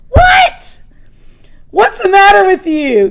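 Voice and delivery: high, loud, puzzled outburst; startled brother. Acted. Brother Gus startled by the yelling asks what's wrong. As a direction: jump at the noise and demand why.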